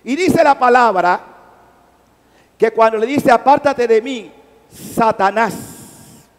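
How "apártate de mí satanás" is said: slow, loud commanding rebuke; stern rebuke. Acted. Jesus rebuking Satan, slow and loud.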